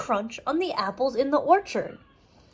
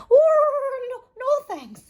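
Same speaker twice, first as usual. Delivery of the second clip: much higher, louder panicked cry; yelling; 4 dB louder, slower than usual. Acted. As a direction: yell it out in panic. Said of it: "he yelled".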